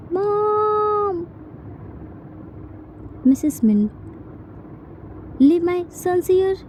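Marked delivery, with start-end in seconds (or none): 0.1-1.3 whiny call for mom. Acted. Son calls for mom.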